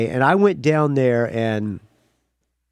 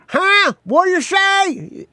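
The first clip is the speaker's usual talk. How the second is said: hammed-up salty skipper who can't hear; imitating the boat captain shouting back; weird old captain; slower than usual. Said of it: "He's like a weird old captain of a boat".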